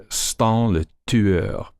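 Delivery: Stan le Tueur said slowly in French; French title. Shown as a demonstration.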